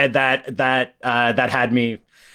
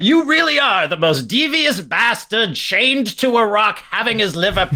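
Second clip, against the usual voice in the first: godly voice; 6 semitones higher than usual. Acted. Mock Zeus voice reply.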